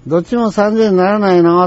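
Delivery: high-pitched, insistent customer imitation; haggling customer. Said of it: "こういう、面白い人やね".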